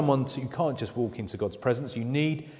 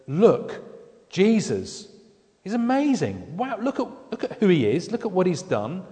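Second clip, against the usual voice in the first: much higher, bursting with wonder; awestruck excitement; 6 semitones higher than usual. Retold or imitated. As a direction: gasp it out like you can't believe how amazing he is. Voicing the writer's awe at Jesus.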